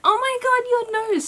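imitating a high, shocked friend; shocked gasp. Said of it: "no one's been like".

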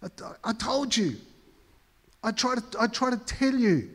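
high, teasing gloat; a pastor mocking his own urge to gloat, in a high teasing voice; mock told-you-so. Mock gloating repeated, laughing.

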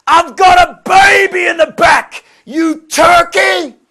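high, loud screaming yell; wife's yell. Retold or imitated. Wife yelling at other driver across the way, furious imitated yell. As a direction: shriek it across the way, dragging out the last words.

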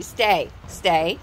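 low firm stay commands; firm dog commands. Real, not acted. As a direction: hold the dog in place.

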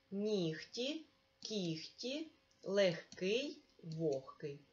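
Нігті, кіхті, легкий, вогкий each said slowly with г as х; careful pronunciation. Shown as a demonstration. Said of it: "Давай перевіримо".

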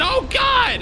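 high, startled cry of alarm; startled yelp. Acted. Rainy testing minecarts as one explodes, startled. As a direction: yelp as something blows up.

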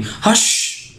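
high, half-whispered hush; narrated hush, mostly whispered. A narrator's reading. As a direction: shush them with a long breath of sound. Character silencing the injured man.

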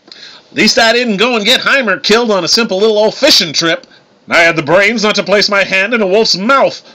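high, taunting jab at a brother; heated bickering. A narrator's reading. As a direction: throw blame back in a quarrel. Brothers trade insults nose to nose.

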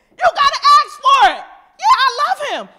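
high, loud preacher's shout; full-force preaching. Real, not acted. Preacher urging people to ask for forgiveness, high full-force shout.

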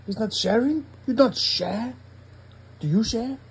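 high, playful teasing at the picture; mock teasing. Real, not acted. Dad teasing the pug in the picture, high and playful. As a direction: needle the greedy pug in a high voice.

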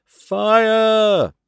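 high, loud, drawn-out yell; ringing shout. Acted. All together raising the fire alarm, shouting. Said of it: "The shout must have rung".

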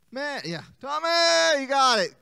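excited cheer. Acted. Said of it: "he's like".

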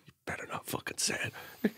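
hushed, pleading whisper; 1 under-breath whisper. Retold or imitated. Pretending to sleep, begging strangers to stay quiet, whispered. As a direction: whisper it so they don't hear. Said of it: "And I was just like".

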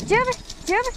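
calling the dog. Real, not acted. Calling dog's name excitedly.

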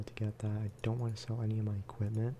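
ordinary talk

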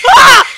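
sudden loud high shriek; startled scream. Real, not acted. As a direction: scream out at a jump scare. Jump scare in horror game.

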